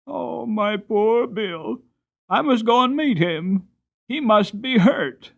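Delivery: high, slow, anxious crying mother; tearful. Acted. Mother fearing her son is hurt, high tearful plea. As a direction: fret over your hurt son. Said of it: "the mother began to cry".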